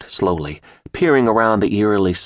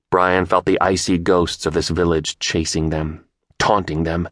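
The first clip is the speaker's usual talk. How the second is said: usual speaking voice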